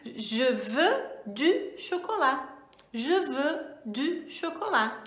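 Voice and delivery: slow careful French review phrases; slow French model sentences; review examples. Shown as a demonstration. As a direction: read the examples out clearly. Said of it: "frases de exemplo".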